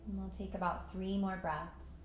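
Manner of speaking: calm meditation voice with long pauses; unhurried, even guidance into stillness; guided meditation. Acted. Yoga teacher settling students into breath and patience, guided meditation.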